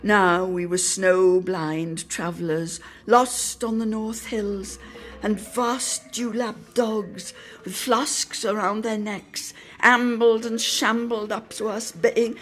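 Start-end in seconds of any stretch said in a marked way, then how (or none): none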